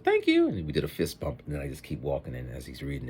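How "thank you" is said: very high, bright thank-you; grateful clerk. Retold or imitated. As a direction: chirp it gratefully. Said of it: "Oh, he said".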